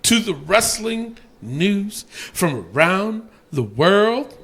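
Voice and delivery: drawn-out announcer delivery; slow segment announcement. Real, not acted. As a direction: stretch out each word to introduce the segment. Announces the next show segment.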